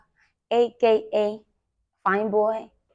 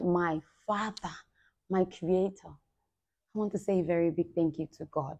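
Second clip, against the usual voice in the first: reverent thanks; 8 dB quieter than usual. Real, not acted.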